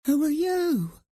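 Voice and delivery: high-pitched nervous mouse. A narrator's reading. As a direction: ask timidly in a small high voice. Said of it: "she asked nervously".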